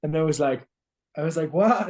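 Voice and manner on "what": startled what. Retold or imitated. Told to go hug the kids.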